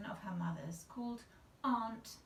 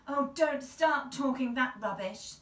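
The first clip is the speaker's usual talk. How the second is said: louder, higher fretful scold; fretfully; 5 semitones higher, 9 dB louder than usual. A narrator's reading. Aunt scolding her old father, fretful. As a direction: fret and scold at once. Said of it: "said Aunt Anne fretfully".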